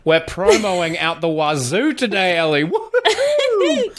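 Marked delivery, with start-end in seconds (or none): 2.6-4.0 a sudden high woohoo with a laugh; mock cheer. Podcast host cheering his own plug, in a high laughing whoop. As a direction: cheer like you've won.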